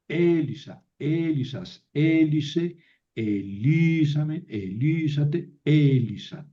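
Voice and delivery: slow measured drill, stressed vowels drawn out; recitado lento para memorizar. Shown as a demonstration. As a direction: chant the forms from Elisa onward slowly, stretching each accented syllable. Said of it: "Así hay que aprenderse". (recitado pausado, alargando las sílabas acentuadas)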